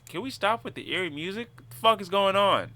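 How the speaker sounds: loud, high exasperated shouting; exasperated outburst. Real, not acted. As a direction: blurt it out, fed up. Sudden outburst at the eerie music.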